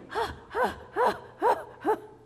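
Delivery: high, loud repeated gasps; exaggerated out-of-breath gasping; acted panting. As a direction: pant hard as if just escaped. Girl recovers after running.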